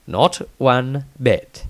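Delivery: ordinary delivery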